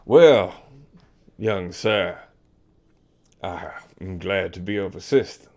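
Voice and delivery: put-on hearty old commodore; an old officer inviting a young one along; old sea captain. GM voices the Commodore.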